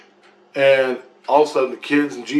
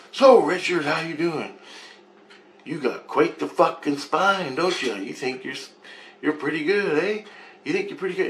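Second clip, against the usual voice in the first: higher, sing-song needling of someone; mocking taunt; 5 semitones higher than usual. A man looming over Richard, mocking taunt. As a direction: mimic a man condescendingly sizing up his victim.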